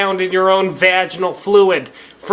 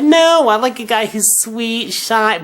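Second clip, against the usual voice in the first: mock sweet girl; 6 semitones higher than usual. Mocking women's stated preference.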